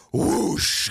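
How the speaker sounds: airy, voiceless blast noise; imitated explosion whoosh. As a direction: make a big rushing whoosh. Imitates the blast through the floor.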